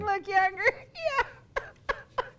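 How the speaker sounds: high, squeaky words through real laughter; laughing joke. Real, not acted. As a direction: crack up while saying it. Joking about surgery, breaking into laughter.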